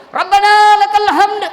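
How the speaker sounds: high, drawn-out chanted shout; muballigh's loud relay. Shown as a demonstration. Muballigh echoing the imam to the congregation, loud high chant. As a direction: belt it out slowly on a high note. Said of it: "Itu muballagah".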